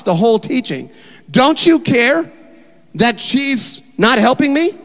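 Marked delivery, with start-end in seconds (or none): 1.3-4.7 high, loud, slow accusing question; indignant Martha. Acted. Martha confronting Jesus mid-teaching, slow loud indignation. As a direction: drag it out in exasperation.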